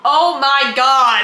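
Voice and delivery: slow, loud mock shock; drawn-out oh my God. Real, not acted. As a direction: stretch it out, scandalized. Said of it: "reacting to the joke".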